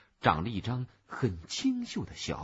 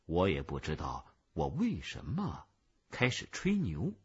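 ordinary delivery, nothing marked

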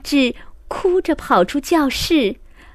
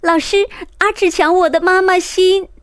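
crying complaint; 7 semitones higher, 4 dB louder, faster than usual. Acted. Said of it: "哭着说".

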